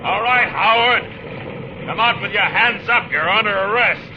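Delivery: loud, slow call across the dark. Acted. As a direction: hail the hidden gunman firmly. Marshal calling out to gunman.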